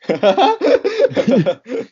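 a sudden high laugh; a real laugh rises high and loud; burst of high laughter. Real, not acted. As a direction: break into laughter. Laughing at his own imitation.

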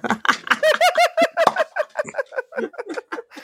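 high giggling laugh; long run of high giggles. Real, not acted. Joking about the podcast terrorist, long high laugh.

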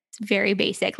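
ordinary talk, nothing marked

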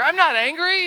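high-pitched, indignant protest; acted touchy self-justification; defensive denial. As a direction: protest innocence while clearly riled. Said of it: "that's how we justify our anger".

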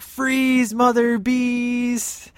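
high, drawn-out mock yell; mock command. Store clerk pulling his gun on Mike, retold mock yell. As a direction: imitate a gunman's order, stretching it out.

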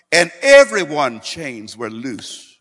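loud, drawn-out proclamation; exultant pulpit shout; triumphant preaching. Real, not acted. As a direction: proclaim it slowly and at full volume. Preacher climaxes the miracle.

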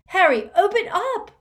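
high, loud demand through the door; frantic shout. Acted. Hermione outside the bathroom, shouting for Harry. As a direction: bang on the door and yell.

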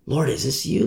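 softly asking Lord, is this you; a hushed, doubtful question; quiet wondering prayer. Acted. Amos quietly asking God if it's him, hushed. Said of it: "He might wonder".

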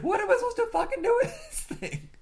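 high, mock-baffled complaint; high mock exasperation. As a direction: squeak it out, baffled. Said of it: "He's like".

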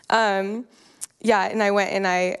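usual speaking voice

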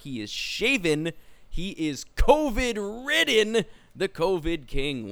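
slow, drawn-out announcer build; grand intro. Acted. Host introducing the covid-ridden guest, slow announcer style. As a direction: savor each title slowly.

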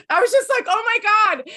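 high breathy gush of excitement; excited gasp. Retold or imitated. Friend swooning over a TV kiss, excited. Reacting to hot kiss scene.

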